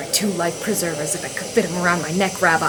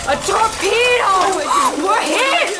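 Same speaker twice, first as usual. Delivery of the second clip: shouting; 13 semitones higher, 5 dB louder, much slower than usual. Acted. Torpedo strikes, shouting alarm.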